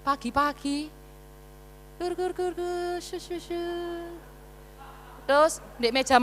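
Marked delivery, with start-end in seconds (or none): 2.0-3.7 soft snoring noises gur and shu; imitated snoring. Pastor's father asleep at home: soft imitated snoring. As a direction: mimic a dad snoring in the morning.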